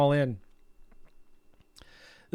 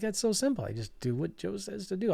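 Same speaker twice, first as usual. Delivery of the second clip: lightly voiced Southern boy, higher and softer; Greg; 5 semitones higher, 9 dB quieter than usual. Student credits his teacher's method, lightly imitated modest ease. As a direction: toss it off lightly as an unassuming student. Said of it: "he's a good old Southern boy".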